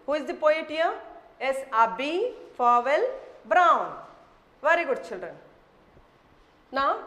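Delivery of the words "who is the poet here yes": normal talking voice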